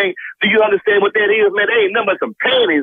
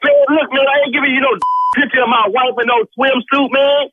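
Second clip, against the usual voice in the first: furious refusal; 8 semitones higher than usual. Real, not acted. Refuses wife's swimsuit picture.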